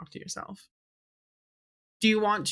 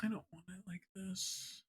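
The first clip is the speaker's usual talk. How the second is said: hushed protest to oneself; quiet grumble; 16 dB quieter than usual. Real, not acted. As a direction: say it under the breath, barely audible. Player grumbles to self at the turn.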